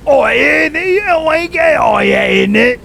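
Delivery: loud, high hammed-up London roadman voice; mock roadman accent. Streamer mocking British chat, loud mock roadman shout. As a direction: shout it like a cartoon London lad.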